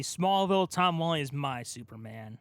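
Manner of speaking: ordinary talk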